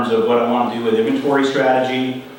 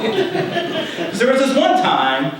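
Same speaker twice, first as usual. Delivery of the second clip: real laugh, high and slow through the words; 12 semitones higher, much slower than usual. Real, not acted. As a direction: chuckle your way into the memory. Laughs as the anecdote starts.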